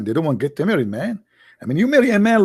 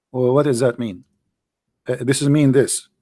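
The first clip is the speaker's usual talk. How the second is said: normal talking voice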